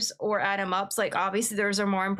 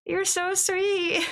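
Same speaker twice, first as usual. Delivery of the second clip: touched; 9 semitones higher, much slower than usual. Real, not acted. Thanking viewer.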